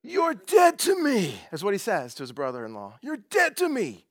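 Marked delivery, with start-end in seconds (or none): 0.0-1.4 slow, high, loud Godfather threat; drawn-out Godfather-style gangster line; gangster impression. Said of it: "That was my best gangster impression". 3.0-4.1 slow, high, loud Godfather threat; drawn-out Godfather-style gangster line; gangster impression. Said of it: "That was my best gangster impression".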